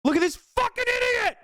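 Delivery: very high, shouted insult; outraged yell. Real, not acted. Streamer yelling at a chat troll. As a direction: blast him with it.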